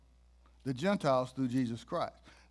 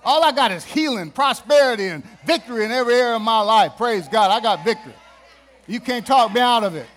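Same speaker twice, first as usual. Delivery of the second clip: shouting, high and loud; preaching; 7 semitones higher, 13 dB louder than usual. Acted. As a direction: preach at full force.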